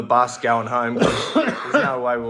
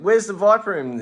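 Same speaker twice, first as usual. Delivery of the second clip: higher, louder, fed-up plea; exasperated question; 9 semitones higher, 8 dB louder than usual. Retold or imitated. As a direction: ask it again, losing patience. Asking a third time, exasperated.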